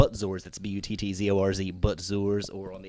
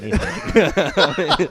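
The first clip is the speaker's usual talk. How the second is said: laughing; 9 semitones higher, 7 dB louder, much slower than usual. Real, not acted. Joking, laughing reply.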